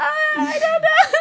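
high, loud laughing panic; mock scared. Laughing as she acts her scared self in the dream prison. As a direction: play the scared girl through laughter. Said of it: "I was so scared".

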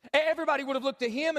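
ordinary delivery

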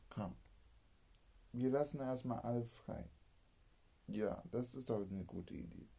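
usual speaking voice

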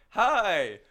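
loud bright hello; cheerful shout. Retold or imitated. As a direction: call out hi warmly. Retold loud greeting, laughing.

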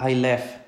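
"I left" said with the final t dropped; swallowed final T. Shown as a demonstration. Teacher showing students the common Spanish mistake, "I left" without its t. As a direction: say "I left" as "I lef", no t at the end. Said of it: "y me coma la T".

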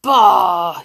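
loud, drawn-out moan of disgust; grumpy, booming complaint. A narrator's reading. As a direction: moan it out loudly. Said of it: "he moaned".

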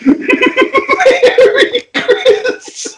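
laughing through the punchline; can't get the words out for laughing. Real, not acted.

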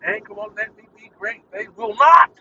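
loud on will not, then it drops off; frustrated outburst. Real, not acted. Podcaster venting at the app, loud then trailing off. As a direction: vent at the app, then fall away.

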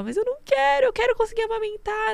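voz bem mais aguda, chorosa de brincadeira; voz de choro imitada. Retold or imitated. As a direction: imita a própria aflição em falsete. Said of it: "E eu ficava". (falsete choroso imitando a si mesma)

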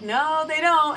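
mock the receptionist pushing back; arguing back.

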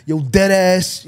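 loud New York twang; New York accent. Retold or imitated. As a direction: sound like a guy from New York. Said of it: "how guys in New York spoke".

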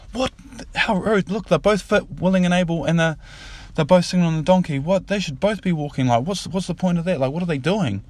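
high-pitched, outraged mock scolding; indignant activist. Animal activist objects to both riding the donkey, indignant. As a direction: act out a scandalised onlooker's protest.

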